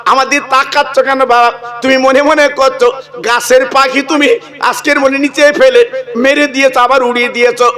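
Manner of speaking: lilting, chanted preacher's telling; sermon storytelling. Acted. Preacher telling the crowd a saint's tale, chanted sermon voice.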